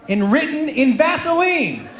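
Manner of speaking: ordinary talk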